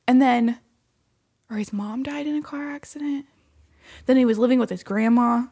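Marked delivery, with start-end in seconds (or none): 1.5-3.2 hushed whisper. Real, not acted. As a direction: whisper the sad detail. Telling of a mother's death.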